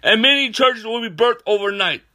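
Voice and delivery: high, loud fervent preacher voice; preaching at full force. Real, not acted. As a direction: proclaim it like a revival preacher.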